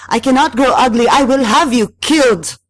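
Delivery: high-pitched, loud wail of rage; anguished, threatening outburst; railing. A narrator's reading. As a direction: rail at the spirit at full voice. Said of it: "she railed".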